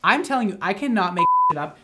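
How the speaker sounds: loud, high, emphatic; emphatic insistence. Real, not acted. Storyteller to viewers after a shocking quote, emphatic. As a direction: swear it's true, raising your voice.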